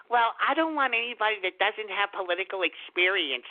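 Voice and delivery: a hint of someone else's complaint; mock objector. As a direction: imitate a complainer with mild mockery. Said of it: "hearing people say".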